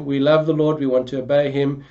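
normal talking voice

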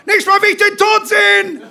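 high, loud sustained yell; furious full-force shout; snapped shout. A narrator's reading. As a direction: bellow it like a rabid touchline dad. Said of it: "schnauzte der Glatzkopf".

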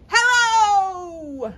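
big high shout of hello; loud hello. Shown as a demonstration. Librarian leads the kids' loud hello, shouting. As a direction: belt it out as loud as you can. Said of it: "Let's say hello as loudly as we can".

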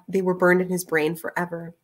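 usual speaking voice

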